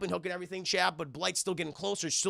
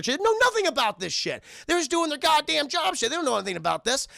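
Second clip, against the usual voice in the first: loud, high-pitched outrage on the workers' behalf; really worked up for the innocent workers; indignant outburst; 10 semitones higher, 5 dB louder than usual. Real, not acted. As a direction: rant it, fed up and loud. Streamer indignant over innocent victims.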